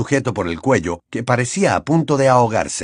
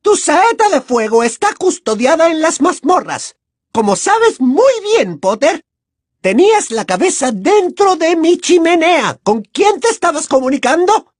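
sputtering foiled villain; 6 dB louder than usual. Acted. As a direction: interrogate with prim cruelty. Umbridge interrogates Harry.

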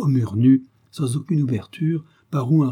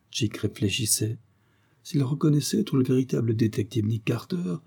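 ordinary delivery, nothing marked

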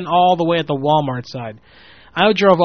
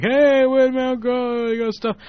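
high, puzzled bystander voice; a puzzled stranger calling after the car; confused passerby; 9 semitones higher than usual. Retold or imitated. Voicing confused people in parking lot.

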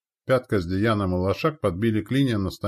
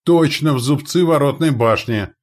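loud, high spotter's cry; excited observer's call; exclaimed report; 8 semitones higher, 8 dB louder than usual. A narrator's reading. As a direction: shout the direct hit to the guns. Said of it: "воскликнул наблюдатель".

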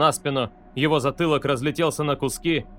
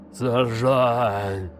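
gurgling, choked cry through blood; slurred gurgle; 3 semitones lower than usual. A narrator's reading. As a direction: gurgle the word out indistinctly. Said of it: "невнятно пробулькал он".

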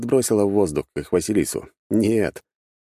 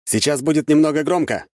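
raised, higher-pitched narrated shout; called-out warning; 9 semitones higher, 4 dB louder than usual. A narrator's reading. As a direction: call out a warning with moderate force. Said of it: "крикнул я". (предупреждающий выкрик повышенным голосом)

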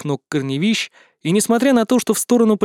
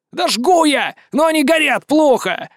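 shouting back; 10 semitones higher than usual. A narrator's reading. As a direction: shout it, frustrated, from above. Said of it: "Также криком отвечал ему Блик". (раздражённый крик)

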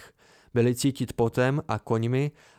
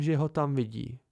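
ordinary delivery, nothing marked

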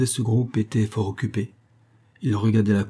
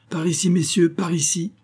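high-pitched rallying call; 10 semitones higher than usual. A narrator's reading. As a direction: call the riders to follow. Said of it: "cria-t-il". (appel lancé d'une voix haute)

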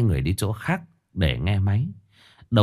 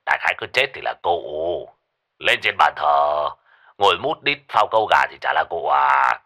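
put-on lower gruff old man; 7 semitones lower than usual. A narrator's reading. Lão Năm cãi.

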